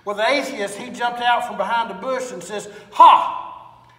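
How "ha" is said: loud, high triumphant "Ha!"; spring out with a gotcha bark; gloating shout. Acted. Atheist jumping from the bush, triumphant gotcha shout.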